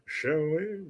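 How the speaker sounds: put-on drawn-out, soft British accent after a gasp; hammed-up British, softly stretched; playful British accent. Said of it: "I just love to do that accent".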